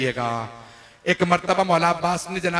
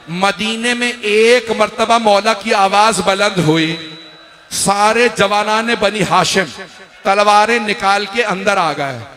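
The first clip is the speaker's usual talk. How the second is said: higher and louder, rising wail; impassioned preaching; 4 semitones higher, 6 dB louder than usual. Acted. Preacher to the mourning gathering, rising impassioned wail. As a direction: build the lament toward the climax.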